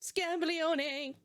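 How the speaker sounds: fake Italian. Shown as a demonstration. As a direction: repeat Scabaglione slowly like Italian. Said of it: "repeat after me".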